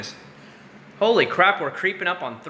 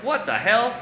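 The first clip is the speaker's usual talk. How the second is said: baffled "what the hell"; 9 semitones higher, slower than usual. Retold or imitated. Baffled by huge tourist groups.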